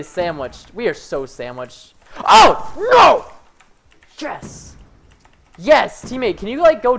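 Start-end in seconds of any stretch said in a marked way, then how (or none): 2.2-3.3 high, loud cry of dismay; dismayed yell. Real, not acted. Loses in game. 4.2-4.8 hushed, breath-only celebration; whispered yes. Real, not acted. Quiet celebration.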